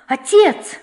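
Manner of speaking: desperate cry. A narrator's reading. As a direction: cry out to father in despair. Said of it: "закричал он отчаянным голосом".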